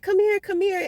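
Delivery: mock baby-talk calling an animal; coaxing a pet. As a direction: coax it like luring a scared cat. Coaxing a cat again.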